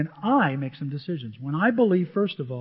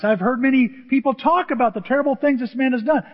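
loud, higher-pitched protest; exclaiming; 7 semitones higher, 7 dB louder than usual. A narrator's reading. Ananias telling the Lord of this man's deeds, exclaiming. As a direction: exclaim it in alarmed objection. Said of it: "exclaimed Ananias".

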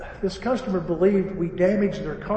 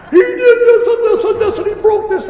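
high, loud mock tirade; shouted accusation; 12 dB louder than usual. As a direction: imitate a customer yelling out a list of wrongs. Acting customer's shouted accusation.

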